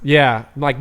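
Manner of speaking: usual speaking voice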